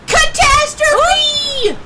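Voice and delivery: drawn-out, high, loud cry of catastrophe; slow, booming, hammed-up wail; dramatic cry. Telling friends of her walk, a hammed-up slow wail.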